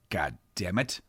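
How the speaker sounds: ordinary delivery, nothing marked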